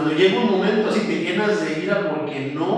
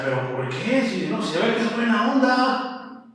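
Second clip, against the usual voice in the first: lightly voiced Pilate, higher and puzzled; 6 semitones higher than usual. Pilate wondering about the accused, lightly voiced and puzzled. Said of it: "Y él decía".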